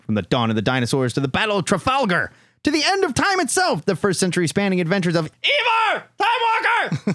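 high, hyped-up promo announcer; big dramatic solicit read. Acted. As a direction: build to a shouted title like a movie trailer. Reads the comic's solicit.

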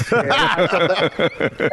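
normal talking voice

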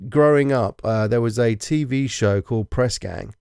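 usual speaking voice

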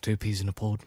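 soft pirate-captain catchphrase voice; Jack Sparrow. Acted. Host quotes the pirate's line, soft Jack Sparrow impression. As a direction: say it as the film pirate, quietly. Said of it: "But what, what does he say?".

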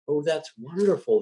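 light up with delight. A narrator's reading. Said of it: "the old man said with delight".

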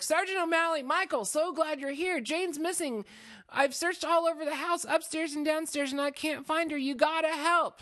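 high, loud, urgent pleading; frantic acted plea. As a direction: beg the policeman in hammed-up panic. Making our plea.

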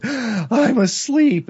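high, very slow, stretched-out words; gasp, then drift high and drawn out; slow mock swoon into sleep; body falling asleep. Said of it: "or your body goes".

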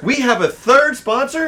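surprised. Real, not acted. Co-host reveals a new sponsor.

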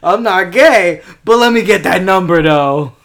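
big booming "ho, ho, ho"; loud mock outburst. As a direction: boom it out like a jolly brag. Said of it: "and I was like".

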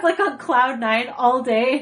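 ordinary talk, nothing marked

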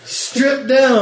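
urgent yell. Pretend. Frantic order, bugs on butler.

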